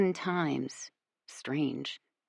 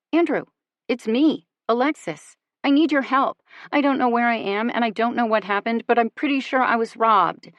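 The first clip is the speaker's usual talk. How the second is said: higher and louder, frantic pleading; panicked; 5 semitones higher, 5 dB louder than usual. A narrator's reading. To her boyfriend, lost and robbed, panicked pleading. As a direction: panic rising as she begs for help. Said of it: "My voice is panicked".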